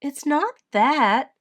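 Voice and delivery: high, slow, acted upset; hammed-up child's upset; sobbed protest. A narrator's reading. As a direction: protest as if tearful. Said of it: "Edith sobbed".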